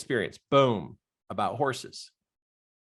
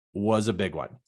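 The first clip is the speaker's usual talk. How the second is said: ordinary delivery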